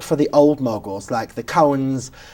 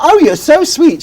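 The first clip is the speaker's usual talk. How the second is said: gushing star; 12 semitones higher, 8 dB louder than usual. Retold or imitated. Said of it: "And she's like".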